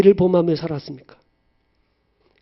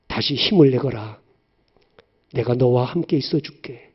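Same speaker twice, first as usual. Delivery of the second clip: tender comfort; 5 semitones lower than usual. Acted. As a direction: soothe a hurting child. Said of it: "주님께서 내게 말씀하세요".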